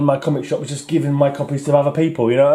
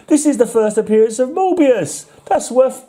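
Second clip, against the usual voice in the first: excited; 9 semitones higher than usual. Real, not acted. Collector thrilled to find Morbius's first appearance, excited. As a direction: gasp with delight at the find.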